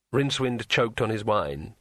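ordinary delivery, nothing marked

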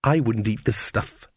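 understated disgust; a touch of bitter contempt; bitterly. A narrator's reading. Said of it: "she said bitterly".

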